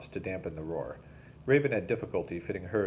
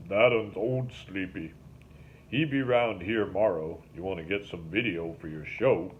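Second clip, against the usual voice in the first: lightly voiced rural boatman, offering; 3 dB louder than usual. A narrator's reading. Link the boatman speaking.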